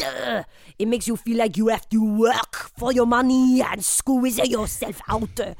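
lightly hammed French accent; a comic Frenchman being interviewed. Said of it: "Pierre Jambon".

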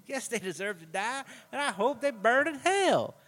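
rising, slowed movie-line intensity; a lightly voiced Samuel L. Jackson courtroom line; quoting Samuel L. Jackson. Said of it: "Where is that from?".

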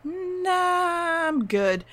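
high drawn-out sing-song "nah"; mock breezy refusal. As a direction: wave it off in a high, stretched voice. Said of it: "he's like".

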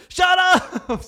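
screaming high and loud; shrill anger. Retold or imitated. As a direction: scream it at her, furious. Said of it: "Så han skriker til henne".

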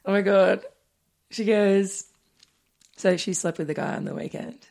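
ordinary delivery

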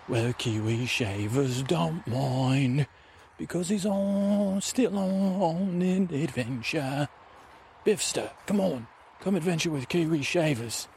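high, drawn-out singsong adventurer; Kiwi Shavers character. Acted. Adventurer coaxing the ranger to come along, high singsong. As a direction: draw it out high, almost singing. Sing-song character invitation.

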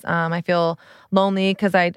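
ordinary delivery

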